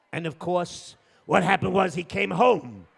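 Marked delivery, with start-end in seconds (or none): none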